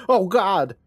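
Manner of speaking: high, hammed-up cry of horror; mock scream. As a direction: cry out in pretend panic. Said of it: "running out of the room screaming".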